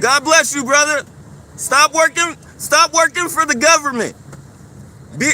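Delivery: loud, mocking blessing; loud call-out. Real, not acted. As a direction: shout a taunt after him. Taunting him as he leaves.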